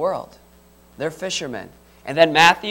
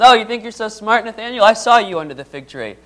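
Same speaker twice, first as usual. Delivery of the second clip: higher, louder, playful jab; teasing challenge; 5 semitones higher, 5 dB louder than usual. Retold or imitated. Jesus teasing Nathaniel about the fig tree, playful and pointed. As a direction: call him out with a knowing grin. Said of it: "And he says".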